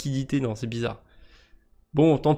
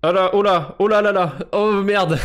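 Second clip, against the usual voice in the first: higher and louder, a sudden oh no; startled laughing dismay; 6 semitones higher, 6 dB louder than usual. Real, not acted. Streamer realizing the pun he just made, surprised laugh. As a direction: caught out by his own accidental pun, laughing. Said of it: "je me suis même pas rendu compte".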